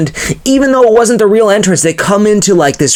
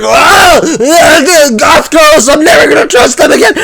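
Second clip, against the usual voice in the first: high, loud comic yell; high, loud, fast wail with laughs breaking through; hammed-up screaming; 7 semitones higher, 5 dB louder than usual. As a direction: scream it out as he's torn apart. Todd ripped apart screaming.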